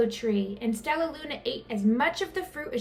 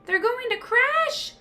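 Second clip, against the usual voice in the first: much higher, slow alarmed gasp; high frightened gasp; 12 semitones higher, 3 dB louder, slower than usual. A narrator's reading. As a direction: gasp in fear for friends. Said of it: "gasped Stellaluna".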